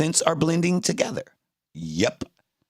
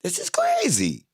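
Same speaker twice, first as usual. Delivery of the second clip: high-pitched exclamation of disbelief; incredulous; 5 semitones higher than usual. Real, not acted. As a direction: let CRAZY leap up high, then fall. Repeats it in exasperated disbelief.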